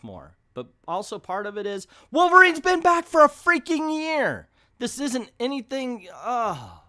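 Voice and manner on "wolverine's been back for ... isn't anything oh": high, loud frustrated yell; exasperated outburst. Real, not acted. Reviewer fed up with a disappointing comic, exasperated yelling. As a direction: blow up in exasperation. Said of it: "Okay, calm down".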